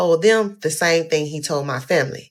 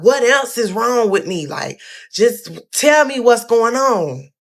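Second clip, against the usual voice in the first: high, loud, slow desperate plea; panicked pleading; 7 semitones higher, 4 dB louder than usual. Retold or imitated. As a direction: beg the nurse, voice rising in panic. Panicked questions to the nurse.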